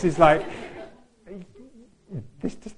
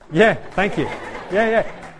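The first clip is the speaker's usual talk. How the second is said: laughing thanks; 8 semitones higher, 8 dB louder than usual. Real, not acted. Laughing reply to compliment.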